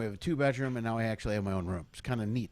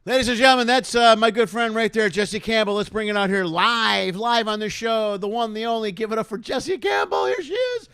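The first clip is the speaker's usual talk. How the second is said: much louder, higher show host build-up; game show style introduction; hype announcer; 9 semitones higher, 10 dB louder than usual. Acted. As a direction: pump up the crowd for the guest. Host introduces comedian live.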